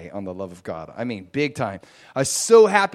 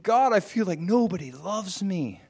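crying out to God; 8 semitones higher than usual. Retold or imitated. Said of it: "crying out to my Father".

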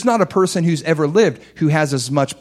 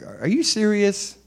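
incredulous; 6 semitones higher, 4 dB quieter than usual. Acted. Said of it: "I'm like".

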